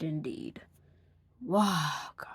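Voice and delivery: hushed whisper of disbelief. Acted. Reviewer whispering in disbelief at the worst episode. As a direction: breathe it out as a stunned whisper. Disgusted aside at top entry.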